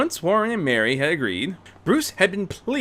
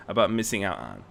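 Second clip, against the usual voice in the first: ordinary delivery, nothing marked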